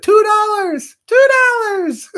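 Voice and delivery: high, loud quoted catchphrase; movie line quote. Acted. Quoting Better Off Dead, high loud movie voice. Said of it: "That was his favorite line".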